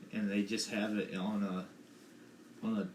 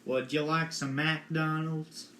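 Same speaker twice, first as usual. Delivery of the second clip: slow southern drawl, higher pitched; a southern accent demo to coach a friend; 7 semitones higher, 4 dB louder, slower than usual. Shown as a demonstration. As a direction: show how the southern accent should go. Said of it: "you gotta have, like, a southern accent".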